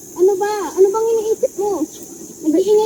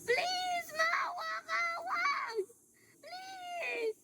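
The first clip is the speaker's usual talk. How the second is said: high, very soft whimpered begging; terrified pleading; 6 semitones higher, 22 dB quieter, much slower than usual. Acted. Victim begs a killer for mercy, terrified pleading. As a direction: beg for mercy in a small, high voice.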